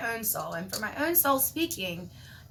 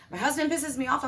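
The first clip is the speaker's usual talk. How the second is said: ordinary delivery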